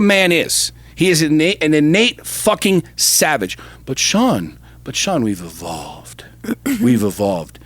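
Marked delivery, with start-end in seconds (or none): 3.9-7.6 lower, softer whiny mock voice of a critic; mocking opponent. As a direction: sneer at the naysayer in a put-on low voice.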